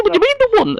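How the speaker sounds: high, puzzled question; incredulous. Retold or imitated. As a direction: ask it in mock disbelief. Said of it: "I said".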